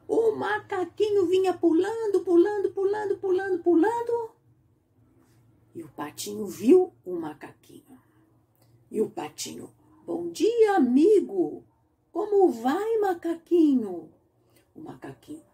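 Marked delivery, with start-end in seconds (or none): 0.1-4.3 monkey excitement. A narrator's reading. Monkey arrives hopping. 10.1-14.1 high, bright greeting from a small duck; children's-story duckling, cheerful hello; little duckling voice. Acted.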